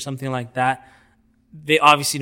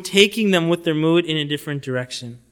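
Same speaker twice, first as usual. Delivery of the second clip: ordinary delivery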